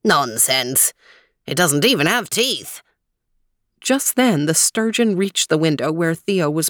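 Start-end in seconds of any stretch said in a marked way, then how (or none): 0.0-2.8 lightly voiced old man, measured and helpful; a hint of a friendly old guide, unhurried; old man Weezer. Weezer dismisses fear.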